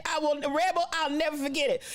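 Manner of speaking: ordinary delivery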